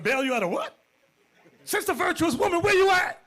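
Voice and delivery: high and loud, astonished; shocked disbelief. Retold or imitated. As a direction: yelp out the disbelief. Bishop shocked on phone call.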